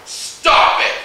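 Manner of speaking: loud stern mom warning. Acted. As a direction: snap it like a mom catching a kid. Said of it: "The mom voice".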